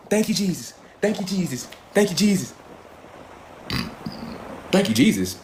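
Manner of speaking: soft, low, devout murmur; mock worshipper. Comic mocking worshippers with a soft devout murmur. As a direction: parody a churchgoer giving thanks.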